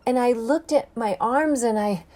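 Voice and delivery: plain reading voice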